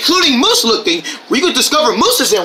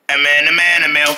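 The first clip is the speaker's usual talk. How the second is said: much lower, booming narrator; deep trailer voice; 9 semitones lower, faster than usual. Pretend. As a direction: drop the voice low like a trailer.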